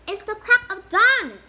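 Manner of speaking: loud, high protest at the early hour; protesting shout. A narrator's reading. Harry protesting to Wood at dawn, loud and high. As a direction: cry out that it's far too early. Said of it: "Harry croaked".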